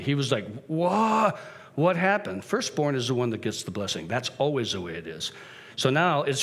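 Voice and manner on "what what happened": higher-pitched mock alarm; acted shock. Father learning of the deception, mildly acted shock. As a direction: burst out with a startled question. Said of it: "Isaac trembled uncontrollably".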